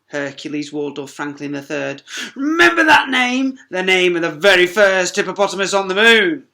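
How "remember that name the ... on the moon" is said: loud grand declaration; pompous proclamation. Acted. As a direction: announce your own glory. Hercules proclaims.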